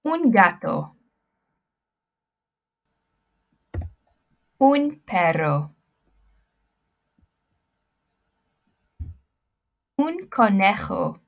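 slow, clear Spanish accent on Un gato, Un perro, Un conejo, Un ratón. Shown as a demonstration. Teacher models pet words in a Spanish accent. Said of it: "repeat the words after me in your best Spanish accent".

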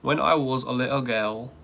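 badly faked British accent; careful put-on British vowels. Shown as a demonstration. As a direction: attempt a British reading. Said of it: "How do you say girl in British?".

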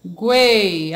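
ENXAGUEI split into EN, XA, GUEI with long pauses; syllable by syllable. Shown as a demonstration. Teacher showing how to separate a word, syllable by syllable. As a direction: draw out each syllable and wait before "Guei". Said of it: "Como eu separo enxaguei".

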